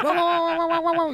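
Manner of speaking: high imitated barking; mock dog barks. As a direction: bark like a dog chasing a car. Dogs chasing a taxi.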